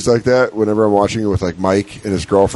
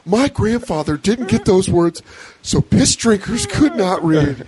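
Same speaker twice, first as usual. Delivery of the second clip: comedian impression; 8 semitones higher, slower than usual. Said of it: "he goes".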